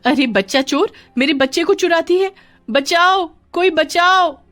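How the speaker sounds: high, loud alarmed shrieks; screaming for help. A narrator's reading. Mother sees her child being snatched, screaming for help. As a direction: scream to rouse the neighbourhood. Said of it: "चीख सुनकर".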